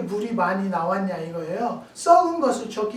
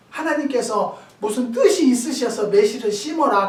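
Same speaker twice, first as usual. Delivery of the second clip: preaching; 3 semitones higher, 6 dB louder than usual. Acted. Farmer preaching to her viewers about God's people, fervent and loud. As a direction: preach it to the congregation with conviction.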